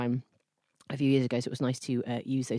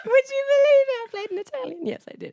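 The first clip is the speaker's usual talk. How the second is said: very high, mock-incredulous Italian accent; caricature Italian accent; 8 dB louder than usual. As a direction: exclaim it in a hammed-up Italian accent. Continuing the caricature Italian demo.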